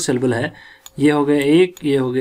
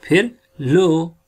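ordinary delivery

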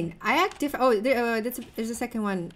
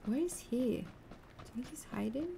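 slow, soft whisper; barely voiced, stealthy question; hushed whisper; 2 semitones higher, 8 dB quieter than usual. Real, not acted. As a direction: whisper it slowly while on the lookout. Searching for a hidden player.

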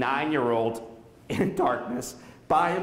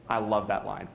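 normal talking voice

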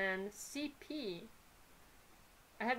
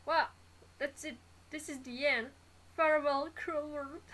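hammed-up last words, pitched up; mock farewell; 5 semitones higher, 4 dB louder than usual. Reader voicing a doomed character's farewell, mock dramatic. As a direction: play at a dramatic goodbye to life.

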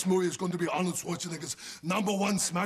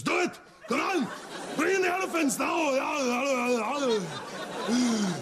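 excited shout; 7 semitones higher than usual. Acted. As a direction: call for the elephants eagerly.

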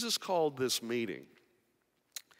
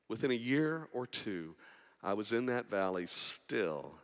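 ordinary talk

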